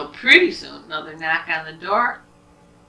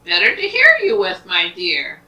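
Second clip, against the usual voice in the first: storybook wolf booming out his answer; big bad wolf; 10 dB louder than usual. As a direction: pounce on the line, much louder. Wolf's menacing reply to Red.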